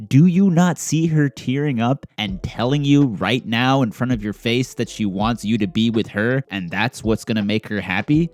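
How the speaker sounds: ordinary talk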